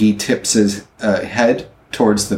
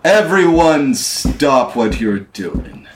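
slow, raised commanding boom; 5 semitones higher, slower than usual. Acted. Captain halting everyone in the tavern, booming voice. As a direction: boom it out slowly to halt the room. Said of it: "you hear a booming voice say".

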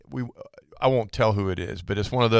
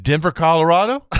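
incredulous question; 5 semitones higher, 6 dB louder than usual. Retold or imitated. Oil only available in Denver.

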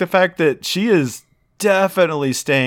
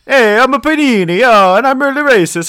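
put-on high loud hammy Italian accent; exaggerated Italian car voice; 8 semitones higher, 5 dB louder than usual. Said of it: "I talk in a thick Italian accent".

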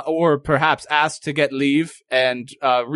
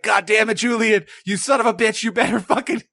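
cursing; 6 semitones higher than usual. Retold or imitated.

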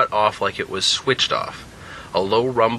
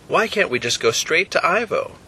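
put-on puzzled young man; 8 semitones higher than usual. Jack voiced higher by narrator.

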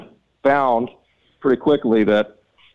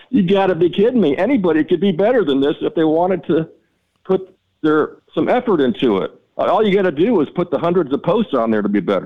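mock outrage, pitched up and pushing; retold exasperated rant; 7 semitones higher than usual. Retelling how he laid into the map company, raised and exasperated.

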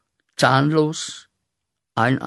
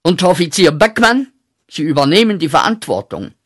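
imitating the general, sharp and faster; clipped military order, loud and higher; general's command; 4 semitones higher, 7 dB louder, faster than usual. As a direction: bark the order like a commanding officer. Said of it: "sagt der General".

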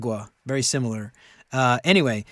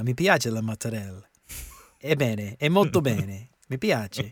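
Italian. Pretend. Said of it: "I can't remember how to say".

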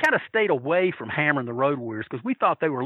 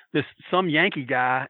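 ordinary delivery

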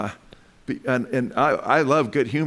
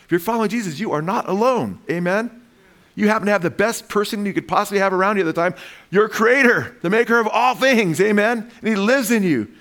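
high-pitched, building to loud proclamation; impassioned preaching; 8 semitones higher, 4 dB louder than usual. Real, not acted. Preacher assures believers they are not alone, impassioned. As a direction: preach it with rising force, peaking on the Creator.

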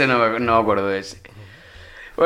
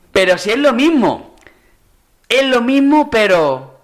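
catchphrase impression; 9 semitones higher, slower than usual. Quoting Martes y Trece catchphrase.

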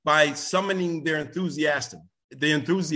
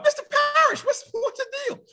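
excited greetings. Real, not acted. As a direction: call out happily to familiar faces. Greeting another audience member.

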